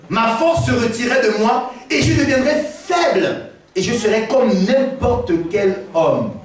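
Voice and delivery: proclamation exaltée. Acted. Samson proclame, prédicateur exalté.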